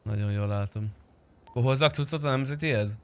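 usual speaking voice

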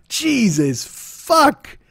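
slow, drawn-out, high Jesus fuck; exasperated. Real, not acted. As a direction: drag it out in disgust. Exasperated at news.

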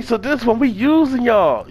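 ordinary delivery

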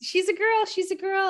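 yes yes, I already know; gushing agreement. Retold or imitated. Scan staff keep showing she's girl.

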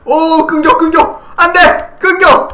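excited high yelling while sliding; sledding downhill. Real, not acted. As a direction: shout in thrilled panic on a fast ride. (썰매 타듯 신나게 외치기)

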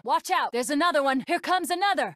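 high, alarmed cockpit warning; urgent warning. Acted. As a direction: warn the pilot of incoming danger. Warning pilot of incoming danger.

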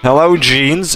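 ordinary talk

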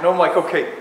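projecting loudly to the back of the room. Real, not acted. No mic, he raises his voice for the audience, loud. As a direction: push the voice out to the far seats. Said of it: "Oh, louder".

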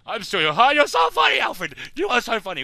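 very high, loud sarcastic yell; mock him with a loud fake laugh tone; loud, high sarcasm aimed at Alfred; sarcastic shout. Real, not acted.

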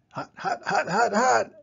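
high yelps of hot; hot feet yelping. Acted. Steve yelps from burning feet, high. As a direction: hop and yelp each hot.

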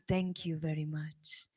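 whispered thanks, low and soft; gentle whispered thank you. Retold or imitated. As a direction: breathe the thanks with a smile. Guru smiles, thanks her softly.

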